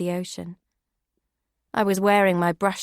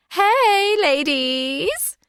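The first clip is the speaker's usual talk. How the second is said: much higher, louder showy call; flamboyant diva greeting; 10 semitones higher, 5 dB louder, much slower than usual. Acted. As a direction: sweep in like a star on the red carpet. Flamboyant housemate arrives.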